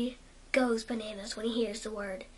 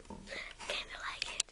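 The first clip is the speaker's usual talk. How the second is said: whisper; 9 dB quieter, much faster than usual. Real, not acted. Whispered self-comment.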